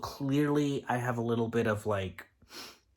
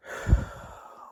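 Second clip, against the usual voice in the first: long breathy sigh of frustration; exasperated sigh. Shown as a demonstration. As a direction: let out a fed-up sigh. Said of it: "I'm gonna show you how it makes me feel".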